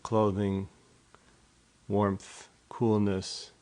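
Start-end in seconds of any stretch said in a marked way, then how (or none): none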